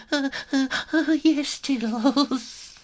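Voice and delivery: very high, stammering yes; frightened kitten. A narrator's reading. Little kitten too scared to refuse Tiddles, very high. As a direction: stutter in fear.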